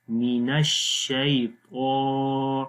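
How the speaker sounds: slow drawn-out tajweed of الشیطان; Quran recitation demo. Shown as a demonstration. Teacher demonstrating slow tajweed to students. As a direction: recite من الشیطان slowly with elongation. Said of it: "نر باریک پڑھیں گے".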